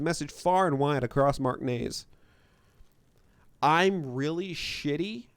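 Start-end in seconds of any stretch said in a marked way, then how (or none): none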